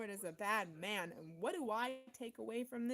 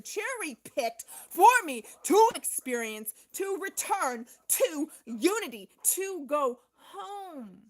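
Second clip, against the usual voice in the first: loud, slow, preaching declaration; impassioned shout; 6 semitones higher, 12 dB louder than usual. Real, not acted. Insisting to viewers that God chose her experiences, loud preaching. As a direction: proclaim it like a sermon, each word pushed.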